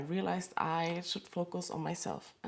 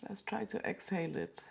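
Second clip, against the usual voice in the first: ordinary delivery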